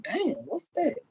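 ordinary talk, nothing marked

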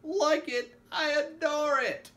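loud, high gushing shout; ecstatic. A narrator's reading. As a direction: belt out adoration, stretching the words. Ecstatic character answers the doubting hostess.

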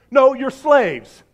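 ordinary talk, nothing marked

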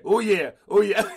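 laughing oh yeah. Retold or imitated. Host imitates guest's reaction to fire bread, laughing. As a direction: imitate his smug oh yeah through laughter. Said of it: "turned his head and said".

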